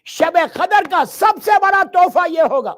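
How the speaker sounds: loud, high, rising sermon cry; forceful preaching. Acted. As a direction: preach it out with full force to the crowd.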